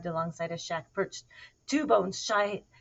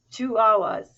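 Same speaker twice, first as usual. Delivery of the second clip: scolding; 3 semitones higher, 10 dB louder than usual. A narrator's reading. Mama cat scolding her kitten, exasperated. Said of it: "she scolded".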